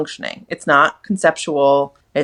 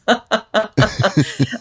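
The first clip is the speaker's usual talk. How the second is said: kind gentle laugh; 4 semitones higher than usual. Real, not acted. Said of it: "I'm not laughing at you".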